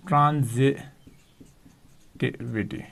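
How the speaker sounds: Transitivity drawn out slowly and softly, syllable by syllable; slow dictation. Shown as a demonstration. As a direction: spell out transitivity slowly for notes. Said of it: "transitivity repeated".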